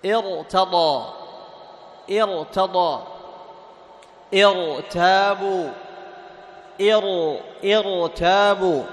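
ارتضى and ارتابوا drawn out with a thick ra; heavy ra. Shown as a demonstration. Teacher models the heavy ra for students. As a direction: stretch ارتابوا, ra full and heavy. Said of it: "ما ترقق".

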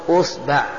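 "أصبع" said as usba', u then a; opening u, middle a. Shown as a demonstration. Teacher lists variants for students, "أصبع" as usba'. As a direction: say "أصبع" with damma on hamza, fatha on ba. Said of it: "ضم الهمزة".

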